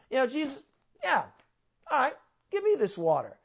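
high, mocking tone; sarcastic. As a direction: say it with a sarcastic sneer. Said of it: "she's actually being kinda, like, sarcastic".

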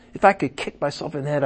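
usual speaking voice

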